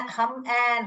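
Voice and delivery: हम एन, split as "hum-an"; wrong short-U reading. Shown as a demonstration. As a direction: say हम एन slowly with a short "uh". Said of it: "आ करके नहीं बोलते हैं".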